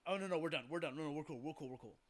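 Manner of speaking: mock panic, talking his way out of a fight; backing down scared. Van Damme backs off from Frank's knee, nervous and hurried. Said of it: "just kinda bitched out and said".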